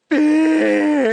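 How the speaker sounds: put-on a high, loud drunken scream of the word; screamed Beer. Coder voicing drunk Nelson screaming for beer. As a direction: yell for a beer like a happy drunk. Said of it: "he'll just scream".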